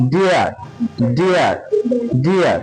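ডিয়ার said with a very short vowel; short sound. Shown as a demonstration. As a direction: keep ডিয়ার short and quick. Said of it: "এগুলো কিন্তু খুব short sound".

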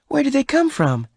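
very high-pitched amazed question; astonished question. A narrator's reading. Bess asks Venters about the cattle, astonished.

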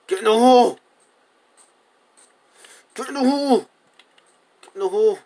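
high, loud yelled command; exasperated shout. Real, not acted. Player ordering cows into the hole, exasperated high shout. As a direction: yell it at stubborn animals. Losing patience with the animals.

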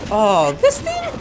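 ordinary talk

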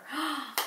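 quick soft gasp of delight. Real, not acted. Finding a mirror in the compact, a soft gasp. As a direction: gasp as if you found a treasure.

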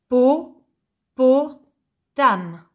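"po-, po-, tam" with a pause after each beat; each syllable of "po-, po-, tam" alone, last one dropping. Shown as a demonstration. Teacher giving a word puzzle, "po-, po-, tam" syllable by syllable. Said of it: "la syllabe qui manque".

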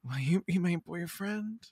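soft, coy little ask; coy proposal. Acted. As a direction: ask it softly, like a shy sweetheart. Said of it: "that's when I'm like".

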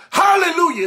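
high, loud testifying cry; testifying church lady. Retold or imitated. Church lady opens testimony service, high and theatrical. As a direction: hallelujah rung out to the congregation. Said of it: "the lady got up in church".

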